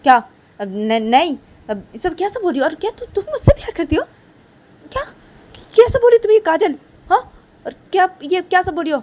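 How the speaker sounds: shocked angry teacher. Acted. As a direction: shriek your disbelief. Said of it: "तो तभी रेशमा तो".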